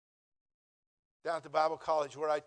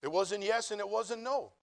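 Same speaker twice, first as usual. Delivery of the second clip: ordinary talk, nothing marked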